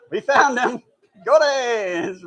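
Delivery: triumphant announcement. Retold or imitated. Caption read triumphantly.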